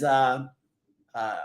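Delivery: ordinary talk, nothing marked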